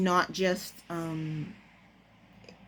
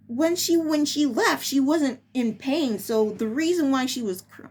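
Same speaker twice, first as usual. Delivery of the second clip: ordinary delivery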